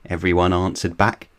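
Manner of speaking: plain reading voice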